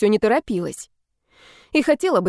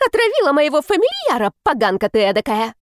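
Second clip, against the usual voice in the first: high shrieking scold; shrill screeching neighbour; 5 semitones higher than usual. A narrator's reading. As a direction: screech the accusation. Angry neighbour yelling.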